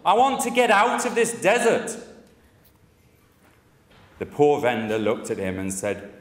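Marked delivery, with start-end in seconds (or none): 0.1-2.0 furious shout. Retold or imitated. Desert wanderer yelling at the vendor, furious shout. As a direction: bellow it out, at the end of his rope. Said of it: "he shouted".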